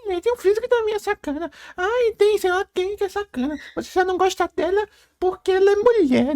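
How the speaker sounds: high squeaky falsetto imitation; mock whiny defender. Mimicking fans who excuse the badly written character, mock falsetto whine.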